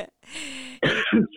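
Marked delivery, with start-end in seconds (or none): 0.0-0.1 throat catching after a cough; strained voice after choking; choking on a drink. Real, not acted. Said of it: "Choking on my words".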